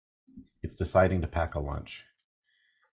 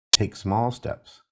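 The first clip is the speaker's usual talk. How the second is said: slow and deliberate; 2 semitones higher, much slower than usual. Acted. Advice slowed down for weight.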